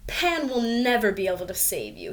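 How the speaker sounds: plain reading voice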